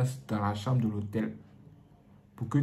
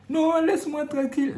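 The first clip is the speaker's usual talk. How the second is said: protesting wife. Acted. Wife protests in acted scene.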